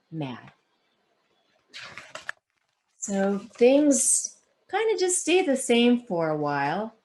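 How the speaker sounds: expressive read-aloud storytelling; story narration. A narrator's reading. Author reading her book to the audience, expressive. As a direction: tell it like reading to a class.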